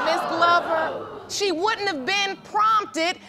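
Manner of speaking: real judge's scolding. Real, not acted. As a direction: cut through the arguing, word by deliberate word. Judge firmly overrides an arguing witness.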